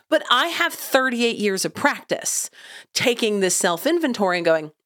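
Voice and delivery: usual speaking voice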